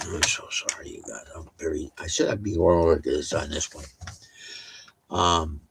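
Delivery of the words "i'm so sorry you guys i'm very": sheepish whisper of an apology; embarrassed whisper to the viewers. Real, not acted. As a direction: apologise under your breath.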